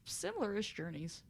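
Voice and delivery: ordinary talk, nothing marked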